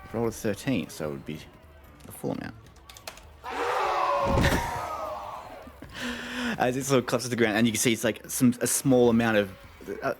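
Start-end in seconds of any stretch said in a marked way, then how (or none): 3.4-6.5 high, breathless laugh; burst of laughter. Real, not acted. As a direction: crack up laughing.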